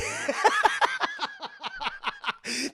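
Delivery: long, very high laughing fit. Real, not acted. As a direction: laugh helplessly, high and loud. Cracks up after the mock proposal.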